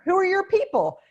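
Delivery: high, loud, gushing small talk; Texan abroad greeting a stranger, high and loud with delight; eager Texan greeting. Retold or imitated. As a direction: gush like you just met a fellow Texan far from home. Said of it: "they do that very Texas thing".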